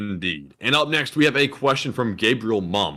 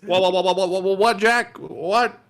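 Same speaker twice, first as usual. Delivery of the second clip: mock sputtering; 6 semitones higher than usual. Mimics cohost's sputtering bit.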